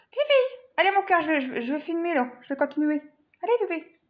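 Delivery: high coaxing voice for the pet; pet talk. Real, not acted. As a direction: sweet-talk the pet into moving along. Coaxing her pet while filming.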